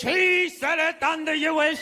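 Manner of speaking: slow mock preacher; slow, lightly pompous old English. Mocking the priest's old English, slow and lightly pompous. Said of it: "speaking in old English".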